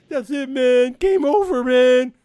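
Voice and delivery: very high, loud panicked shout; panicked yell. Retold or imitated. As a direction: wail it like the marine losing it. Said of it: "He's like".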